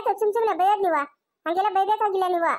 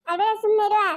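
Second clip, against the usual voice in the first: ordinary talk, nothing marked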